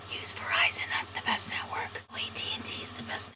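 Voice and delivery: whispered ad voices in your ear; creepy subliminal commercial whisper; brainwashing whisper. Pretend. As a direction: hiss the slogans like a voice in your head.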